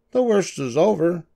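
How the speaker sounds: high-pitched character voice; small, high doctor voice; little mouse dentist, reassuring. Acted. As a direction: reassure the patient, pitched well up.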